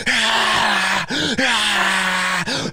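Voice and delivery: hoarse mock crowd cheering; repeated drawn-out roars, imitating a crowd; imitated crowd roar. Host imitating a crowd cheering swished shots, mock roar. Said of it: "the crowd's just like".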